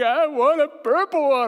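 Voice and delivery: put-on high, loud kid whine; dad acting out his son wanting purple; whining toddler. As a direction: copy the demanding little boy. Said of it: "And he's like".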